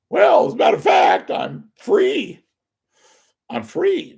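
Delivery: high, loud, eager imitation of a woman; mock flirty woman. Imitating a woman inviting an inmate, high and eager. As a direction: ham up a woman begging to visit.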